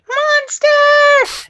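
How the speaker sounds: put-on high, loud childish scream; screaming kid. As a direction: shriek it like a panicked kid. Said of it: "the kid screamed".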